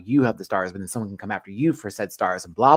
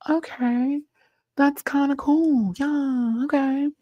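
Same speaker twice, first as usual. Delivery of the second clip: high squeal; slower than usual. Real, not acted. Reacting in high put-on voice.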